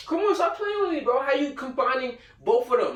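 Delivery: incredulous. Real, not acted. Incredulous reaction to the drawing.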